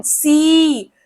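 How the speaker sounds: "सी" held out with long ee; full long SEE. Shown as a demonstration. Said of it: "सी पूरा बोला जा रहा है".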